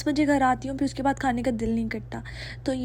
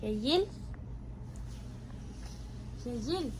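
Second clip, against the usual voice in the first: धीमे से जगाना; 13 dB quieter than usual. Real, not acted. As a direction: gently call the little one awake. Waking her sleeping toddler. (सोते बच्चे को धीमी, नरम आवाज़ में जगाना)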